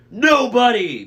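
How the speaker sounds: loud, high enraged bellow; angry yell. A narrator's reading. Cyclops yells back at Annabeth, enraged. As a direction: roar the name back in rage. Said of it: "Polyphemus yelled back".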